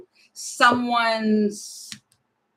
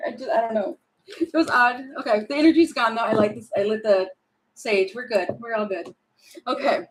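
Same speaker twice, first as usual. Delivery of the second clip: ordinary talk, nothing marked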